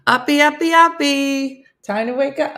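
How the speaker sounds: high, loud sing-song wake-up; mom's wake-up call. Retold or imitated. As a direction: call the kids awake like mom.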